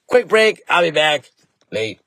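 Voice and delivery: ordinary delivery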